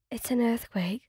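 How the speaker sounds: frightened, breathy hush; whispering in panic during an earthquake; scared whisper. Acted. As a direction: barely get the words out in fear. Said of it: "your voice barely above whisper".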